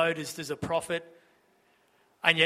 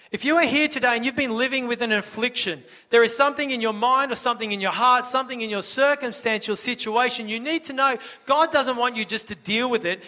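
raised, higher preaching appeal; fervent preaching; 5 semitones higher, 5 dB louder than usual. Real, not acted. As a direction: press the message home to the congregation. Preaching at full force.

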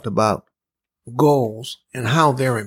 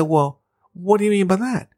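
surprised; 7 semitones higher than usual. Retold or imitated. Retold surprise at salesperson's remark.

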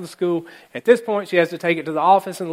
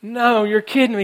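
exasperated; 5 semitones higher, 5 dB louder, slower than usual. Retold or imitated. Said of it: "And she's like".

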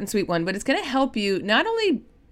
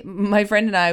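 ordinary talk

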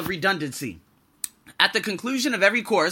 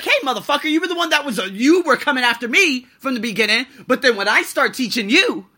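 higher, louder furious telling-off, building to a blast; angry retort; 5 semitones higher, 5 dB louder than usual. Retold or imitated. Teacher telling off the head teacher, raised and furious. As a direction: let him have it, voice raised high. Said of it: "And I was like".

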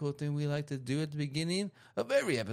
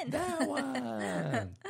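laughing; 6 semitones higher than usual. Real, not acted. Echoing co-host, cracking up.

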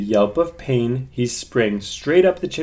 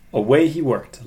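plain reading voice

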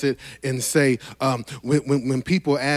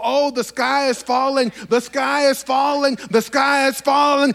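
panicky Chicken Little; 8 semitones higher than usual. Retold or imitated. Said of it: "like Chicken Little".

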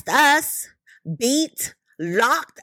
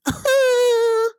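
high, wordless "hoo"; exasperated hoot; 10 semitones higher than usual. Real, not acted. Reacting to police helping her down, exasperated hoot. Exasperated exclamation after are you serious.

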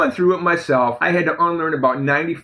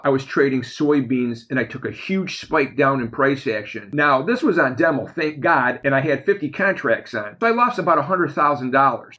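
normal talking voice

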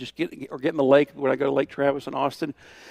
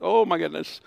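surprised; 5 semitones higher, 5 dB louder than usual. Retold or imitated. Woman dumped powder on head.

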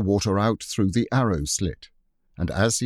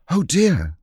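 dismayed; 10 semitones higher, 6 dB louder than usual. Acted. Anthea dismayed at hurting someone.

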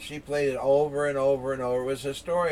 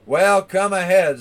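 loud, raised dare; challenging big goat; 4 semitones higher, 9 dB louder than usual. Acted. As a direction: stand up to the troll with a hearty call. Big goat dares troll.

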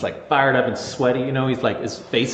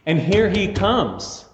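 excited; 6 semitones higher than usual. Acted. Preacher builds excitement about Jesus.

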